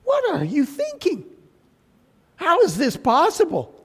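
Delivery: high-pitched mock disbelief; acted-out incredulous scolding; incredulous rebuke. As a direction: scold someone in exaggerated, high-pitched astonishment. Indignant mock rebuke of a Pharisee.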